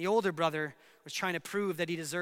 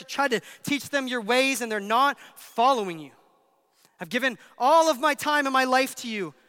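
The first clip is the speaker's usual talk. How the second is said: aggrieved complaint; 3 semitones higher, 7 dB louder than usual. Acted. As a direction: build into a mild protest at being overlooked. Older son's complaint to God.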